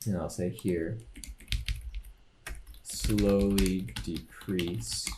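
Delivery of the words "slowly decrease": very slow, drawn-out dictation; typing aloud. Real, not acted. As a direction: stretch the words out as you type them. Narrating code comment while typing.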